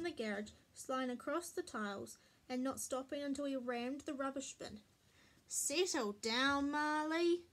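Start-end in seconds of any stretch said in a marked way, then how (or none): 5.5-7.4 slow, drawn-out telling-off; mild scolding. A narrator's reading. Mummy reins in the racing dog, mild scolding. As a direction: scold a boisterous dog, with restraint.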